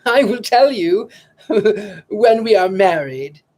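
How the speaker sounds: laughing it off with a forced little laugh. Acted. Sybil dodging her betrothed's question, forcing a laugh. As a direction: push a nervous, put-on laugh through the words. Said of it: "began to laugh rather forcedly".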